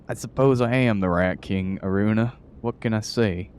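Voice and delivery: a hint of a Southern rat king; southern accent. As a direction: lean into a folksy Southern voice. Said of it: "Rat Kings have a southern accent".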